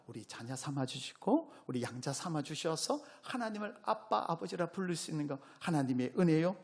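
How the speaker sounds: ordinary talk, nothing marked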